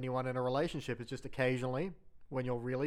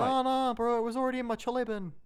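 much higher, fast Kiwi excuse; squeaky hammed-up Kiwi denial, bro; mock New Zealand suspect; 8 semitones higher than usual. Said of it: "Police ten seven, bro".

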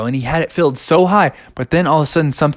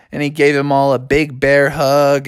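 plain reading voice

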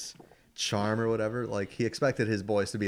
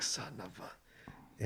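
slow, whispered mock curse; under the breath, drawn out and unfinished; muttered curse; 13 dB quieter, much slower than usual. Shy boy realizing he was picked, cursing quietly.

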